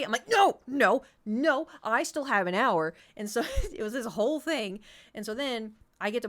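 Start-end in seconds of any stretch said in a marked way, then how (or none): 0.3-2.9 loud, high no's, slowing into firm insistence; emphatic refusal. Retold or imitated. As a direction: shut a pestering brother down. Refusing brother's demand, retold quarrel.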